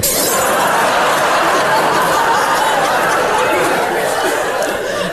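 long breathy burst of laughter; big laugh. Real, not acted. Host laughs at own joke.

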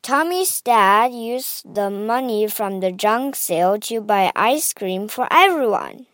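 usual speaking voice

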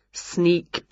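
ordinary delivery

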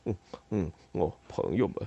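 low, soft mumble; quiet low muttering. A narrator's reading. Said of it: "嘴里低声嘟囔着".

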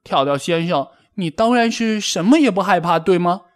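high-pitched sarcastic jab; huffy mock politeness; indignant challenge. A narrator's reading. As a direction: throw it back at the teaser, pitch climbing. Angry rabbit challenges the teaser.

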